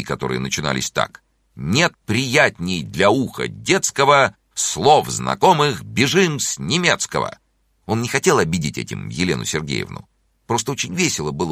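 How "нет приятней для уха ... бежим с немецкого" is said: slow, rhythmic schoolboy poem recital; reciting verse. A narrator's reading. Small boy's poem about skipping German, slow rhythmic declaiming. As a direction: declaim the verse with marked, swinging beats. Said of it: "Он даже написал стихи".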